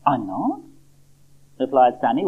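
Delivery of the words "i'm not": a touch of wide-eyed surprise, softer; mildly astonished little seed; surprised. Acted. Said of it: "wide-eyed with surprise".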